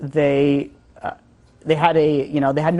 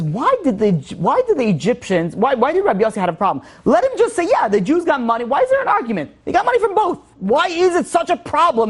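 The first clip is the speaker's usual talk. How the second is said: animated, high-pitched objection; exasperated questioning; 9 semitones higher than usual. Acted. Rabbi challenging another's argument to students, exasperated questioning. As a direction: argue it in rising exasperation, voice leaping high.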